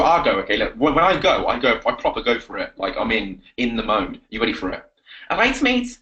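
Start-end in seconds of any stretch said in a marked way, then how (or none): 5.3-6.0 high-pitched put-on Scouse; Scouse accent. Shown as a demonstration. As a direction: launch into hammed-up Scouse. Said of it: "that's the Scouts".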